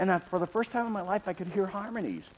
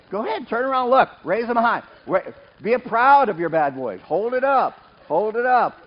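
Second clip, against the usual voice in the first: louder, higher rousing call; rallying call; 5 semitones higher, 7 dB louder than usual. Real, not acted. Preacher urging the congregation to lift their hands, rousing. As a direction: fire up the crowd to raise hands.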